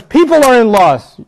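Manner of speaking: loud, high, drawn-out declaration; forceful proclamation. Acted. Preacher quotes a warning to all people, loud and emphatic. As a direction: proclaim it slowly to everyone.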